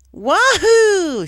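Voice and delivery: high, loud whooping cheer; joyous cheer. Acted. Pony cheering alone after her loop, elated whoop. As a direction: let out a huge victory whoop. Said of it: "she cheered".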